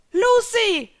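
high, loud, alarmed scream; screamed name. A narrator's reading. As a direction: scream out to warn a friend. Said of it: "schrie Elsa". (gellend geschriener Warnruf)

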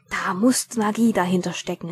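usual speaking voice

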